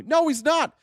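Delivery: ordinary talk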